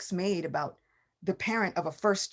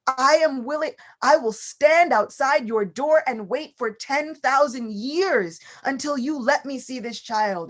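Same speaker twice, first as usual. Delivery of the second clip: lamenting; 6 semitones higher, 6 dB louder than usual. Acted. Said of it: "Lord Shiva begins to lament".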